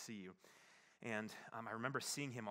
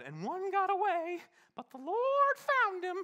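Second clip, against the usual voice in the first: put-on much higher and louder, slow, quavering old woman's voice; old lady's dramatic line; 15 dB louder, slower than usual. Imitating the old lady's cliffhanger, high and quavering. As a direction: deliver the cliffhanger like a dramatic granny. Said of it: "the story always inevitably had".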